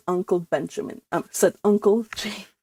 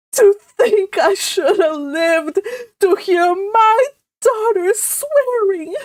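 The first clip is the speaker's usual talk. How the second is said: high, loud, slow sob-laden wail; hammed-up crying mother; sobbing; 11 semitones higher, 6 dB louder, slower than usual. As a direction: wail through mock sobs. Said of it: "sobbed Missus Frederick".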